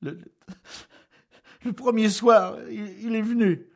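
high, loud, broken stammer. A narrator's reading. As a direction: stumble on each start, then blurt it out.

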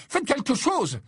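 high, panicked plea; urgent yell. Acted. Harry begging his professor to save Ron, yelling. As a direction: cry out for immediate action. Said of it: "hurla Harry".

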